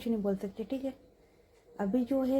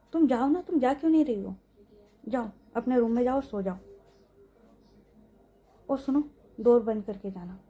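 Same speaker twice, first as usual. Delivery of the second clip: urgent order; 4 dB louder than usual. Acted. Repeats order to leave.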